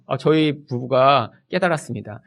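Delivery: usual speaking voice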